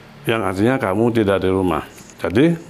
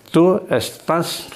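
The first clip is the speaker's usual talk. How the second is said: Tu estas slow, Tu raised higher; slow model phrase; 6 semitones higher, slower than usual. Shown as a demonstration. Teacher models Tu estas slowly, Tu lifted higher. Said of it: "terserah mau di mana".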